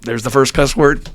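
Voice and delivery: ordinary talk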